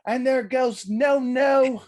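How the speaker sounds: high, slow, put-on British commentator; British commentator calling it slowly; Nigel's British accent. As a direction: stretch it like a British announcer. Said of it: "Nigel would be like".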